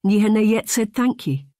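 put-on Scottish accent, gruff Highlander. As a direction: speak as a blunt Highland man in Scots. Highlander Blair speaks.

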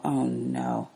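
soft, low, mumbled oh no; mumbled dread. A narrator's reading. Scared boy sees his bullies coming, mumbling softly. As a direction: mutter it under your breath in dread. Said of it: "Albert mumble".

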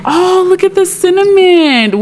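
high-pitched, sustained cooing; delighted coo. Real, not acted. Gamer spots something sweet in the game, high delighted coo.

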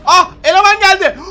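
normal talking voice

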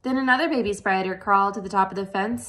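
ordinary delivery, nothing marked

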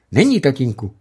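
put-on high, loud eager boy; boy's voice. A narrator's reading. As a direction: chirp it like a proud young son. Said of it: "řekl chlapec".

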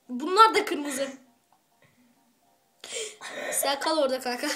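usual speaking voice

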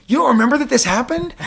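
high, loud hammed-up indignation; an incredulous mock rant; mock outraged Deadpool. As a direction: play Deadpool ranting at his teammate. Deadpool scolding Domino, retold.

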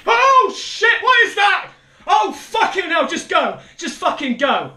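real panic, screaming to get away; terrified shouting. Real, not acted. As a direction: shriek high and loud, easing slightly. Monster appears, player flees.

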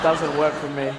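normal talking voice

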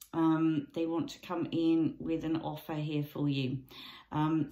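ordinary delivery

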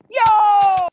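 very high, loud yo; excited gamer yell; hoarse shout. Real, not acted. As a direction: scream it. Shout, throat hurts after.

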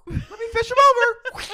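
squealy impression. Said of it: "She goes".

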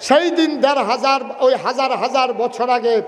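ordinary delivery

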